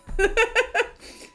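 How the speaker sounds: high, loud burst of laughter. Real, not acted. After admitting she never recovered, a loud high laugh. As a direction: crack up at yourself.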